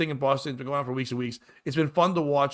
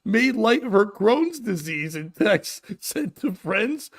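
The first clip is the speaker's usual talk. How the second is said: laughing; 7 semitones higher, 3 dB louder than usual. Real, not acted. Laughing while reading.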